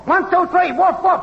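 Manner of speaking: high, rhythmic woofing chant; dog-talk mic test. Acted. Technician woofing at the mic for Max, high dog talk. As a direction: woof it high, like a human test signal. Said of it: "the dog talk".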